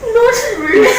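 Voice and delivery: laughing shout. Real, not acted. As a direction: yelp it through laughter. Overwhelmed by English joke. (natatawang sigaw)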